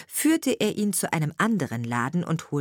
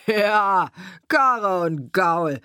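scoffing carter; 6 dB louder, much slower than usual. A narrator's reading.

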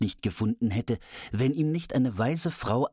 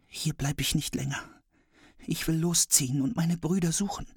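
put-on young girl voice; 7 semitones higher than usual. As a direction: think it aloud like a determined girl. Girl thinks to herself.

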